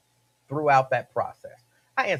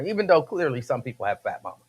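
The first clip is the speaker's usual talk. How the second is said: normal talking voice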